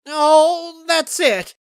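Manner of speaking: high, loud outburst; bursting out. Acted. Pinkie losing patience with her friends, loud high outburst. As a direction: explode with pent-up frustration. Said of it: "unable to contain it any longer".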